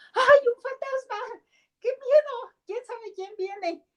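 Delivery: high, squeaky panicked cry of fear; mock frightened disciples. Disciples see a ghost on the sea, high panicked mock fright. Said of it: "así han de haber dicho".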